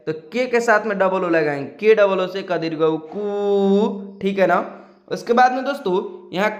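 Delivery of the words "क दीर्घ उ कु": "कु" stretched into a long oo; long vowel. Shown as a demonstration. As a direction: hold the final oo of "कु" well past normal length. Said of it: "बड़ी है तो थोड़ा खींचेंगे".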